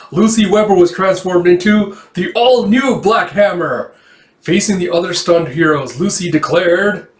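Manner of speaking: high, loud previously-on announcer read; dramatic recap narration. Acted. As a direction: read it like a grand comic-book recap. Reads last recap line.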